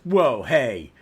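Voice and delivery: loud, indignant acted rant; angry tirade. Young hero telling off a stern superhero, loud indignant rant. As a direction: go off on them, punching the key words. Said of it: "Jack goes off on Batman".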